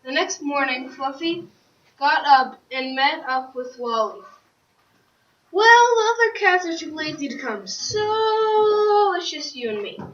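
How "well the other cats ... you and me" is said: acted cat dialogue. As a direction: pipe up high and stretch out the "so". Cat speaks to Wally in story.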